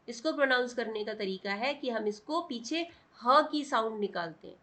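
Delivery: normal talking voice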